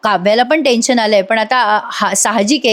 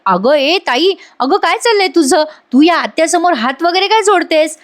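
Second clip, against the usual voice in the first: higher-pitched, indignant mock scolding; retold annoyance, pitched up in protest; mildly angry Kavya; 7 semitones higher than usual. Kavya challenges her sister's begging, mildly indignant. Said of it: "काव्याला पण राग येतो बरं का".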